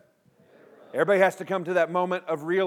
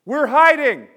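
Adam cries out; 6 semitones higher, 10 dB louder, slower than usual. Retold or imitated. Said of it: "But Adam cries out".

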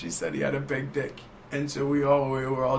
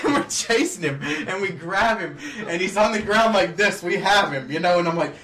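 ordinary delivery

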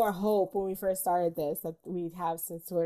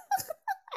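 a quick high burst of laughter; high short laugh; 4 dB quieter than usual. Real, not acted. Host laughs after calling movies dire.

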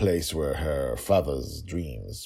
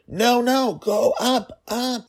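high, loud frantic pleading; urgent father; 8 dB louder, slower than usual. Acted. Father urging daughter up to the roof, frantic high voice. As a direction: wave her off, frantic and high.

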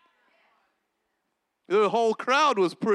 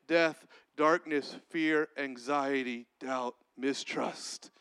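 fading list; 8 semitones lower, 6 dB quieter, slower than usual. Acted.

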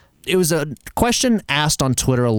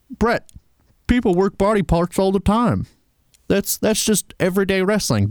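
Southern twang, mocking; Southern accent; 7 semitones higher than usual. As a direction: play a snarky Southern fan. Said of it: "Don't know why you have a Southern accent".

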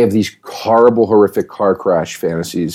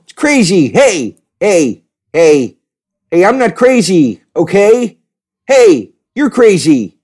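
much higher and louder put-on voice; Sam Rockwell; 9 semitones higher, 4 dB louder, slower than usual. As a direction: play it like a twitchy Sam Rockwell. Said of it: "That's my Sam Rockwell".

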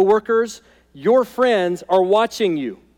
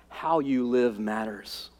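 quiet earnest emphasis; 6 semitones lower, 7 dB quieter, slower than usual. Acted.

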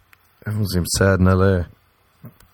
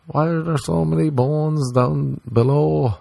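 naive American tourist; 7 semitones higher than usual. Retold or imitated. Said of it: "American tourists would show up and be like".

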